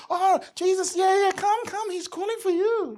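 high, gushing, ingratiating voice; fawning. Retold or imitated. Crowd sucking up to Jesus, high fawning voice. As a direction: gush to win Jesus's favour. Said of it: "all of a sudden".

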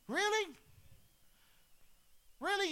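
preacher incredulous at people's spiritual amnesia; incredulous really. Real, not acted. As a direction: challenge the room in mock disbelief. Preacher challenges congregation.

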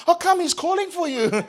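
high, giggly, sucking-up tone; fawning. Retold or imitated. Crowd eagerly ushers the beggar forward, high fawning voice. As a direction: gush with fake friendliness. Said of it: "they changed their tune".

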